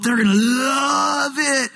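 hammed-up enthusiasm; excited promise. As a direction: gush it, stretching the word love. Acted sales pitch within a prayer.

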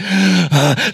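heavy acted panting breath; exhausted gasp. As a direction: puff hard, out of breath from the swim. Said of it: "he's panting".

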